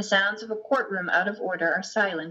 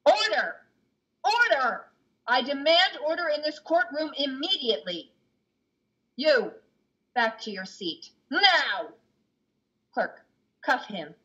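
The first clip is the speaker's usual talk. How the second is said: judge shouting order; 7 semitones higher than usual. Acted. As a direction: cut the uproar with a barked command. Judge silences the rowdy court.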